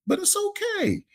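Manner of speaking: very high, playful consoling voice; high-pitched reassurance. Pretend. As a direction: reassure him way up in falsetto. Consoling co-host over number mix-up.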